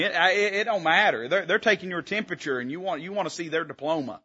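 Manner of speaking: ordinary talk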